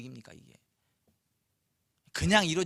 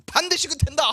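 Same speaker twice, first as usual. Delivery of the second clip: high and loud certainty; firm declaration; 9 semitones higher, 8 dB louder than usual. Retold or imitated. Isaac declares the blessing final, high and forceful. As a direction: declare it settled, no doubt.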